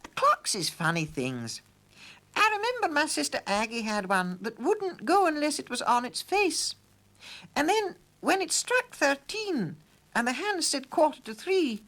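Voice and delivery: put-on high, chatty old housekeeper. A narrator's reading. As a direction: gossip cheerfully over pudding. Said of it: "put in Missus Flittersnoop".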